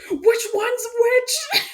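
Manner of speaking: high, slow and wailing; mock panic. Player seeing two boyfriends, mock panic. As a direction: drag it out in frightened confusion. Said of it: "I was like".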